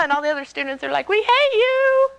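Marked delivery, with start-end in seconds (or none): 1.1-2.1 high, drawn-out mock whine; mock resentful chorus. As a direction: stretch it out as jealous classmates in a high voice. Other students' jealous chorus, acted out.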